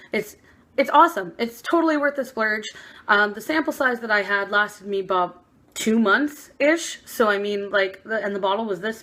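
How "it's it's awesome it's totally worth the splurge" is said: excited. Real, not acted. Gushing over favorite product.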